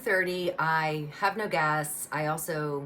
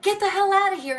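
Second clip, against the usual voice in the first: much higher and louder, a yelled order; retold shout; 9 dB louder, faster than usual. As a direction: bark it at the thugs to get out. Shopkeeper with bat yelling.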